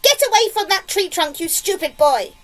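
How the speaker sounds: high, loud telling-off; scolding yell. A narrator's reading. Aunt orders boy away from the tree, angry yell. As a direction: yell it high, easing down towards the end. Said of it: "Aunt Spiker yelled".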